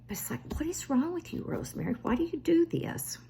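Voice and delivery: high, airy whisper of disbelief; exasperated self-scolding. Retold or imitated. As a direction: hiss it at yourself in fed-up wonder. Scolding herself over the boat.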